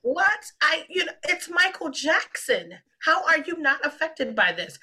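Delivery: high incredulous pitch, slowing down; retold shock. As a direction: disbelief at his calm. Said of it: "I was like".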